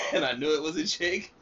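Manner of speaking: ordinary talk, nothing marked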